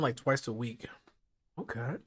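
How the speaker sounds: ordinary talk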